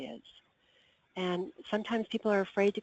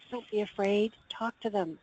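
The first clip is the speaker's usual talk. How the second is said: normal talking voice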